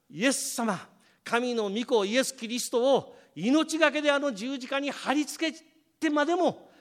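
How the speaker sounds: high, loud preaching build; full-force preaching. Acted. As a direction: preach it to the crowd with fervor. Preaching climax on the cross.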